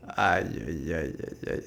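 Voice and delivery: low, quiet pained groan; wincing groan. Real, not acted. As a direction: wince as he gets hit. Caster winces at a hit.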